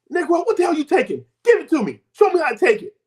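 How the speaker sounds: high-pitched mock scolding; hammed-up, high, bossy demand; demanding wife. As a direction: imitate a wife insisting on getting her share. Wife demands the product, retold.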